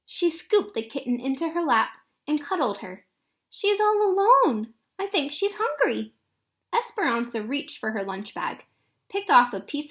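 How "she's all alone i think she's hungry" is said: put-on worried little girl. Girl worried about kitten.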